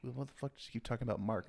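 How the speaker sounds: quietly imitating the confused hero; hushed mock complaint. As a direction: say it under your breath.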